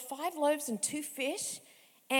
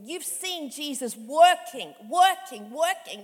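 loud, slow, pounding repetition; preaching emphasis; 2 semitones higher, 11 dB louder, slower than usual. Acted. Preacher to congregation, pounding the word loudly. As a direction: drive each working home like a hammer.

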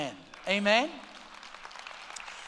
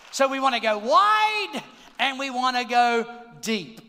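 loud preaching; 7 dB louder than usual. Real, not acted.

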